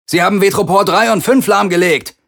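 high, loud urgent call with a hint of panic; shouting over the noise. A narrator's reading. Captain shouting the damage report over the alarms, panicky. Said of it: "rief Akina über den Lärm".

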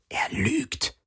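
very soft secret whisper. A narrator's reading. Garland warns Akina about the caller, in a soft whisper. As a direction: whisper it for one listener only. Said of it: "flüsterte er Akina zu".

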